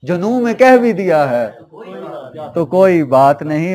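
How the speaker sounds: projected, ringing verse delivery; ghazal recitation. Acted. Poet repeating a couplet to a cheering audience, projected recitation. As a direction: declaim the couplet for applause.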